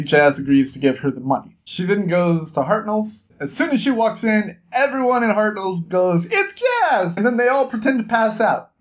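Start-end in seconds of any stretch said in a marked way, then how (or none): 6.3-7.2 high-pitched acted exclamation; mock crowd greeting. Everyone in Hartnell's as Jazz walks in, high mock excitement. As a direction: call it out like a whole bar spotting someone.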